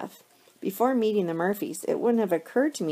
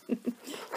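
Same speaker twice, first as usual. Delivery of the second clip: short, breathy little laugh; light laugh; 6 semitones higher, 9 dB quieter than usual. A narrator's reading. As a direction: give a small, quick chuckle. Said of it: "She laughs out loud".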